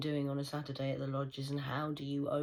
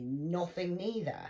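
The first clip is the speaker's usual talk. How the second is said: ordinary talk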